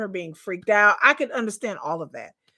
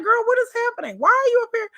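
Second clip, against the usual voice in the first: put-on high incredulous girl what is happening; playfully baffled at her tears; mock disbelief; 13 semitones higher, 4 dB louder than usual. Talking to Taylor in her head, high and incredulous. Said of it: "I'm just like".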